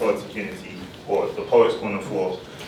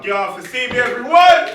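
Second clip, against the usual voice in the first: rising to a loud, higher shout; hype shout; 12 semitones higher, 6 dB louder than usual. Real, not acted. Host shouting to the crowd after his turn, loud rising shout. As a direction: whip the room up.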